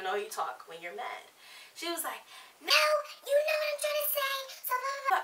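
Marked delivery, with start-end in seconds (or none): 2.6-5.2 very high mocking voice; high-pitched mock roommate; imitating arguing roommate. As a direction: put on a squeaky protest. Same mocked roommate arguing back.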